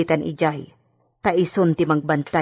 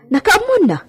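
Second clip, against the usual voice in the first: much higher and louder, sudden alarm; shocked question; 6 dB louder than usual. Acted. Maid to fellow maid, alarmed that the don knows. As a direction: jump up in pitch with alarm.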